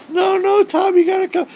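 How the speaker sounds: very high pleading call; coaxing plea. Retold or imitated. Urging the turtle to come over, high pleading. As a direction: beg the turtle to swim over.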